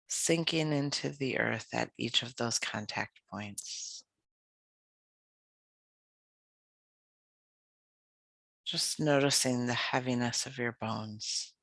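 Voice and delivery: calm, unhurried yoga nidra voice with long pauses; guided meditation. Acted. Leads a guided relaxation practice.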